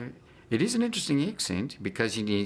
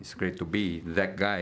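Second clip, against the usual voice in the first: normal talking voice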